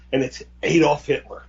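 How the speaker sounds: ordinary delivery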